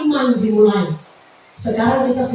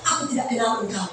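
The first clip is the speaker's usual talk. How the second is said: much softer, cold verdict; God's quiet rejection; 2 semitones lower, 9 dB quieter, faster than usual. Pretend. God tells the preacher he never knew him, hushed. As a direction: say it hushed and final. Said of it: "Tuhan bilang".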